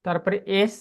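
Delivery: ordinary talk, nothing marked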